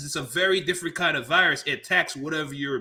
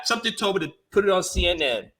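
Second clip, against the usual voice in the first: normal talking voice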